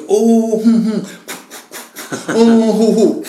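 loud, high mimed huffing and puffing like a train; train chugging noises. Retold or imitated. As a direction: act out a steam train with loud puffs. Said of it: "后来他就跟我比划". (高声大声模仿火车"呼哧"声)

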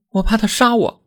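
high woman's voice, fearful; frightened wife. A narrator's reading. Wife tells husband she fears being killed, high and scared. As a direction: voice your fear to him.

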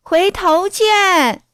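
high, slow drawn-out farewell call; singled-out high holler, long vowels; kids yelling back. Acted. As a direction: call it out from afar, stretching the words. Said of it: "邻家的孩子冲着他大叫".

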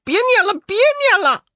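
high, loud pained pleading; crying out in pain. Acted. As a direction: beg him to stop the spell. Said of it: "叫苦说".

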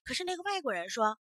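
ordinary delivery

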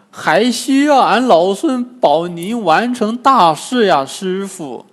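high, loud character voice; insistent high-pitched plea; pleading Monkey King. A narrator's reading. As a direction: appeal earnestly to the master. Wukong pleads to stay.

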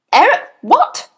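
loud, high, incredulous question; shouted. A narrator's reading. Headmistress disbelieves the boy's name, incredulous shout. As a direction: shout it back, rising sharply on WHAT. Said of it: "the Trunchbull shouted".

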